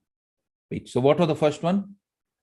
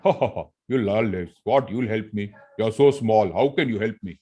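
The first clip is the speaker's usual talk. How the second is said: a touch lower, scornful laugh; baritone lion, mocking; 3 semitones lower than usual. Lion laughing at the mouse's offer, low and scornful. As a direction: scoff at the tiny mouse from down low. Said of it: "Baritone, right?".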